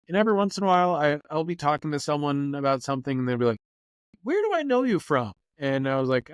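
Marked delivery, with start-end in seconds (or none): 4.2-5.3 1 mimicking curious stranger. Fan recognizing him, high voice.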